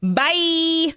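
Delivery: high, loud sung-out bye; cheery bye. Real, not acted. Cheery signoff.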